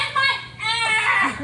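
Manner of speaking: slow, loud high shout; mock shout. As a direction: grab it with a dramatic yell. Grabbing phone, shouting.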